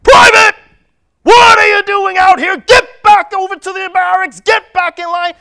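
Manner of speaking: loud, high barked drill sergeant order; sergeant's shout. Retold or imitated. A sergeant yelling at a freed private to get back to the barracks, shouting. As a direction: bellow it like a sergeant across the street. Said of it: "the sergeant yells over".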